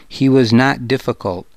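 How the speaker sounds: ordinary delivery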